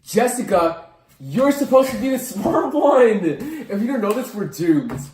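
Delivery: loud, high-pitched comic panic; panicked outburst. Acted. Student to exam partner who forgot everything, panicked outburst. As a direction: wail at your partner as if all is lost.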